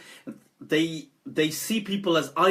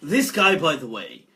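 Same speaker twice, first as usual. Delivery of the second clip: ordinary talk, nothing marked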